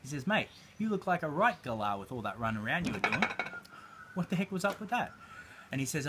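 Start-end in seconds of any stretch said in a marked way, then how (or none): 0.3-5.1 put-on a light Australian accent, ribbing a stranger; lightly voiced Aussie businessman, teasing. Businessman at the bus stop teases the client, light Australian accent. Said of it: "I'll try and do the accent as best I can".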